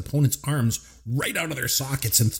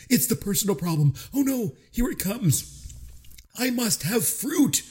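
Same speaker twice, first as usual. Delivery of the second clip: panicky Vincent; 9 semitones higher than usual. Retold or imitated. Vincent quoted panicking, high slow.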